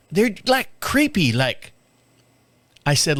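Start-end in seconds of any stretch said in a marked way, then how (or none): 0.1-1.7 higher Tennessee twang, creeped out; mock Tennessee twang, spooked. Neighbor's wife calling the guests creepy, Tennessee twang. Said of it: "she's got a little twang to her".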